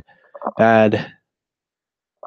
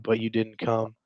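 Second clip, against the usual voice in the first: normal talking voice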